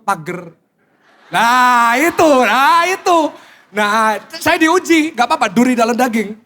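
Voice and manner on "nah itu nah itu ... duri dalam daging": excited teasing. Real, not acted. Pointing out helper in congregation.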